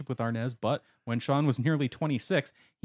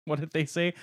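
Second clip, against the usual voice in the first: usual speaking voice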